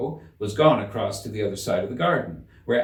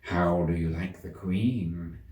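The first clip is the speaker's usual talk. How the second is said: lower, slow, sly cat voice; cat's low voice; 4 semitones lower, 3 dB quieter, slower than usual. A narrator's reading. Cat asks Alice privately about the Queen, low and slow. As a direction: lean in and ask it low and slow. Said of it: "said the cat in a low voice".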